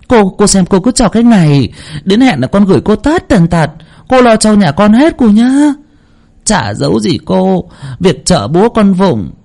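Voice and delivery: high-pitched, breezily generous mother; mother laughing it off. A narrator's reading. Mother assures the medium money is no worry, high and effusive. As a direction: play an expansive mother brushing off the cost. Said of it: "bà mẹ tự dưng cười xòa".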